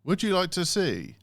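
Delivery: high, slow robotic cinema line prompt; automated phone voice. Retold or imitated. Imitating an old cinema booking line to her cohost, robotic. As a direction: mimic a stiff pre-recorded phone menu. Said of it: "So they'd be like".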